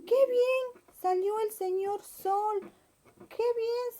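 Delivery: high, delighted bunny voice; a rabbit glad the sun came out; happy little rabbit. Acted. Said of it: "el conejito dijo". (conejito feliz, voz aguda)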